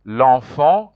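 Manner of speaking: L'enfant with nasal en, lon-fon; French pronunciation. Shown as a demonstration. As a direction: model L'enfant's nasal vowel. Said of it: "you pronounce like that".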